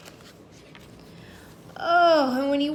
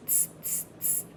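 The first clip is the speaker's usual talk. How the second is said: soft unvoiced tss for the kids to copy; monkey tsk sounds; 15 dB quieter than usual. Shown as a demonstration. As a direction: show the kids the monkey noise. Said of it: "Be the monkeys".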